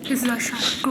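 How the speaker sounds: usual speaking voice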